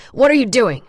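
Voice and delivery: bully him awake; harsh demand. A narrator's reading. Orderly confronting Lawrence.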